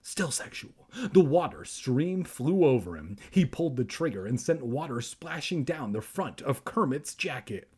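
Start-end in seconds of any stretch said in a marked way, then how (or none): none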